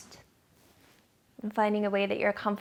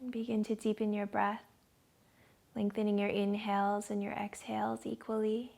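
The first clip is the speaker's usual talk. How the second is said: guided meditation; 6 dB quieter than usual. Acted. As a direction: ease them into calm, dropping to a whisper on the breath.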